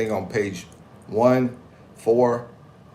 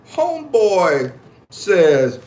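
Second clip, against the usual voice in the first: dramatic buildup; 8 semitones higher, 6 dB louder, much slower than usual. Real, not acted.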